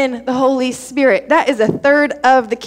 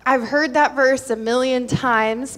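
ordinary talk

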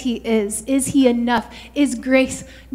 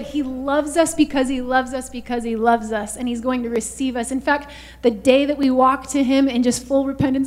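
ordinary delivery

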